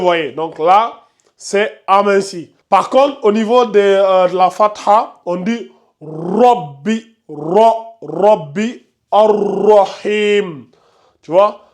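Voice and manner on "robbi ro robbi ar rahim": robbi and ar-rahim with a full, heavy r; slow robbi, drawing out a thick emphatic ra; ar-rahim with a full, heavy tafkhim ra. Shown as a demonstration. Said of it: "on fait la lettre emphatique".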